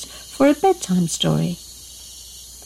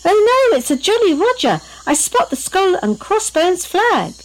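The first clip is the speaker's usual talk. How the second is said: very high, dramatic lookout's alarm; excited storybook cry of danger; alarmed exclamation; 11 semitones higher than usual. A narrator's reading. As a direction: exclaim it in high-pitched dismay.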